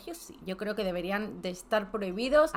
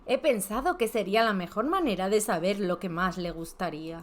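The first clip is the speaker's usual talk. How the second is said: ordinary talk, nothing marked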